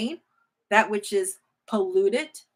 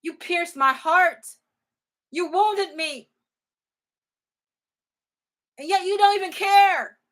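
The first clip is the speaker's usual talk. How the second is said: higher, rising into an anguished plea; hurt lament; 8 semitones higher, 4 dB louder than usual. Acted. God rebuking careless priests, rising into a hurt cry. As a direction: build from hurt to a pained cry. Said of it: "He said".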